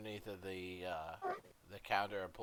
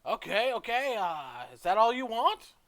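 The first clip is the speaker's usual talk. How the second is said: high, loud shopkeeper voice; shopkeeper character; 13 semitones higher, 12 dB louder than usual. Acted. Shopkeeper checking the customer's order, high and loud. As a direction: pitch it right up, ending on a question.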